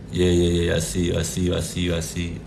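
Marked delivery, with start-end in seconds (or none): none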